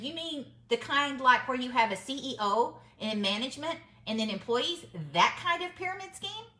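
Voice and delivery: retold comeback, pitch rising into a challenge; pointed challenge. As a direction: challenge them with a mock-puzzled question.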